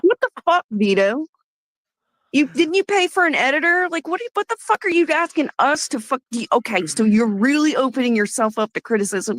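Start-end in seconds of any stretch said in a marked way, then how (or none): 0.0-6.5 angry disbelief. Real, not acted. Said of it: "I am so fucking mad".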